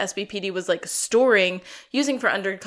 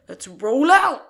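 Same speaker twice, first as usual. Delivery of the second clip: much louder, put-on tough voice; tough cop command; 4 semitones higher, 10 dB louder, slower than usual. Scarman orders the squad out, loud tough voice. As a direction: bark it like an action hero. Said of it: "Scarman is like".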